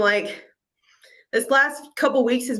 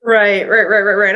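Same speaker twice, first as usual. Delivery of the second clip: loud emphatic agreement; 3 semitones lower, 9 dB louder than usual. Real, not acted. Emphatic agreement, much louder.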